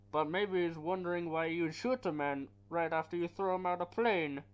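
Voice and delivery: Bane voice. Retold or imitated. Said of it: "Then you hear his voice".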